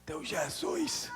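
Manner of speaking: imitated feeble father, high and strained; slow, thin, effortful patient's voice; speaking low. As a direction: drag out the words weakly, in a high voice. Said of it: "tava falando baixo".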